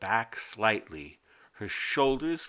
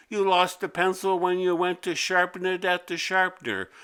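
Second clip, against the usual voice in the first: higher, louder scolding teacher; exasperated teacher; 6 semitones higher, 3 dB louder than usual. A narrator's reading. Teacher scolding Mimi for losing pencils, higher and louder. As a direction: scold her, fed up. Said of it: "teacher scolding".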